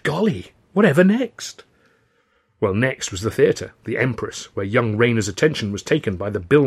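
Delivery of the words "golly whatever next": put-on high, loud boyish amazement; exclaiming. A narrator's reading. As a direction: marvel at it, pitch climbing. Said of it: "Rayner exclaimed".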